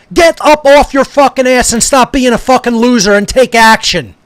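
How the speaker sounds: ordinary talk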